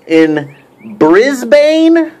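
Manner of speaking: very slow in Brisbane, stretched; drawn-out Brisbane. Shown as a demonstration. Mocking the Brisbane meetup, stretched out. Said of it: "What I heard".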